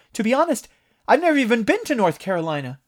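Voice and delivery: loud, high, fast protest aimed across the room. A narrator's reading. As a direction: call out so the monster hears you. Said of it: "raised his voice, so the monster could hear".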